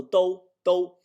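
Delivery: high, loud "do" held level, rhyming with "oh"; first-tone do. Shown as a demonstration. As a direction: hold "do" up high on an even tone. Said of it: "Homer wouldn't say it with the first tone".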